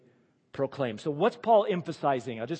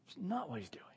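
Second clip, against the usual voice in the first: whispered aside; 5 semitones higher, 10 dB quieter than usual. Real, not acted. Aside to congregation.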